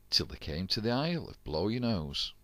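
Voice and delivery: ordinary delivery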